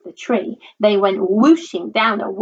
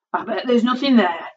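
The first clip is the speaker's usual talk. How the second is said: put-on scornful voice; scoffing. A narrator's reading. Dad strides onto the bridge, scoffing. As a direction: scoff it as a lightly voiced father. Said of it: "and scoffed".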